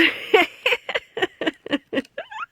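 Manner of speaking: high, giddy giggle; delighted laugh. Real, not acted. Delighted by notebook gift.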